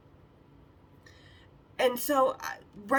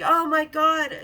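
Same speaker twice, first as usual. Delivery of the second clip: loud, high, amazed exclamation; sweet gushing; 6 semitones higher, 9 dB louder, slower than usual. Retold or imitated. Sales girl bowled over by beauty, sweet gushing. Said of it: "she was so sweet".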